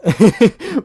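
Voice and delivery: sudden high laughing outburst; loud high burst of laughter. Real, not acted. Laughs at his own idea.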